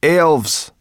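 high, loud warning; one-word cry of dread; alarmed exclamation. A narrator's reading. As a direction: blurt it out in alarm. Sorrel names the danger.

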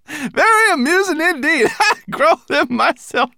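high creature voice breaking into laughter; laughing hard. A narrator's reading. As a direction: talk through fits of laughter. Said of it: "gasped Berber Chan".

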